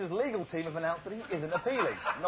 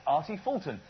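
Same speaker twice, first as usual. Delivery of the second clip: ordinary delivery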